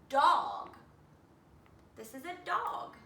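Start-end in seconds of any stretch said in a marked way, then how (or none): 0.1-0.7 dog said firm and loud; blended word. Shown as a demonstration. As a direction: land on the whole word. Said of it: "If I say it a little faster".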